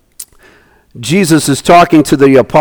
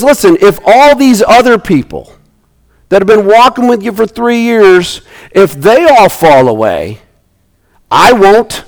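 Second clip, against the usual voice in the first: boasting Peter; 5 semitones higher than usual. Acted.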